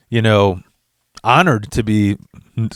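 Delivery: usual speaking voice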